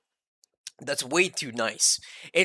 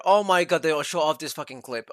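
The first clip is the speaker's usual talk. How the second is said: ordinary delivery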